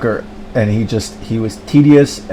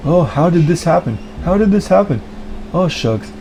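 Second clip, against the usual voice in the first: much higher, whiny mock dismay; bewildered sucker; 7 semitones higher than usual. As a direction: play a helpless dope wondering what happened. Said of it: "I'm gonna go".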